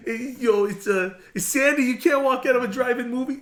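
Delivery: high, loud, whiny pleading impression; hammed-up New York greaser on the verge of laughing; John Travolta. Teasing co-host with a movie quote, hammed-up John Travolta voice. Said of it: "sounded more like the John Travolta kind of thing".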